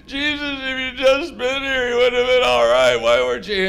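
hammed-up tearful reproach; mock crying. Mary crying at Jesus for coming late, mock tearful. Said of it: "only she's really crying".

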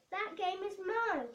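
a touch of a spoiled snap; snapped. A narrator's reading. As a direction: bite the words off, mildly cross. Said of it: "she snapped".

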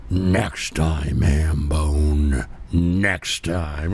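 host mimicking the cartoon villain, low and menacing; Inspector Gadget villain. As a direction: snarl the threat like a cartoon bad guy. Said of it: "the Inspector Gadget heel coming at me".

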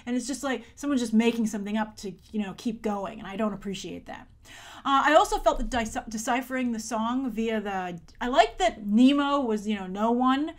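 ordinary talk

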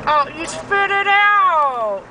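ordinary talk, nothing marked

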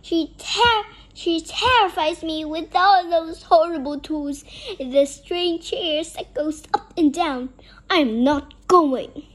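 loud acted outburst; fearful protest. A narrator's reading. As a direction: refuse loudly, as a scared storybook vampire. Vampire character protesting a dentist visit.

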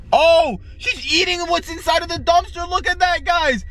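high-pitched, over-the-top disgusted outcry; shocked disgust. Pretend. Vlogger showing viewers Peppa eating from the dumpster, shocked. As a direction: squeal it in hammed-up horror.

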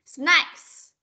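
"Snacks" with the final S clearly hissed; hissed ending S. Shown as a demonstration. Teacher repeating the ending sound for a pupil, hissed final S. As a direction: model "Snacks", letting air through on the last S. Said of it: "ending sound S này".

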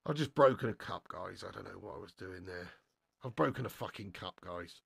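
very low, quiet confession; dismayed, subdued. Real, not acted. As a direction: drop right down, owning up to a breakage. Has broken partner's mug.

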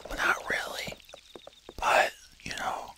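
hushed, voiceless whisper; secretive quiet game commentary. Real, not acted. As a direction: whisper so the family won't hear. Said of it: "I'm a little quiet right now".

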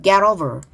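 "get over" run together as ge-rover; linked T. Shown as a demonstration. Teacher showing students "get over" linked, T flapped. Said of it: "트 발음 연음돼서".

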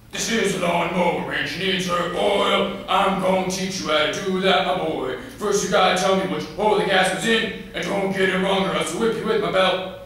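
loud, high barking dad voice; domineering father. Acted. Daddy teaches his son mower mechanics, loud and domineering. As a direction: bellow the lesson like a threatening father. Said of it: "Daddy taught me about mechanics".

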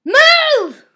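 a high, loud yell of move; furious shout. Real, not acted. Gamer shouting at a blocking player, furious. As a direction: bark it at the player in the way. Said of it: "I'm getting angry now".

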